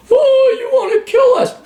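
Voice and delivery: high, whiny mock of an accusing patient. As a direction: mimic the accuser in a shrill falsetto. Mocking patient voice in argument.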